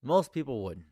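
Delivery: normal talking voice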